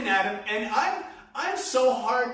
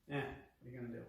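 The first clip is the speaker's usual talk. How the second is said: shrug; 9 semitones lower, 17 dB quieter, faster than usual. Retold or imitated. Shrugging it off to onlookers.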